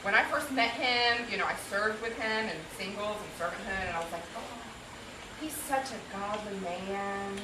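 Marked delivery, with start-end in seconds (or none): 4.3-7.4 soft, slow, acted reverent sigh; quietly swooning quote; hushed admiration. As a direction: gush softly and slowly, as if smitten. Acted admiring first impression of him.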